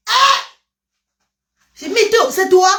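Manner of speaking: high, loud startled question; mock surprise. Retelling how she wasn't recognised, high surprised yelp. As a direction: act out the shock.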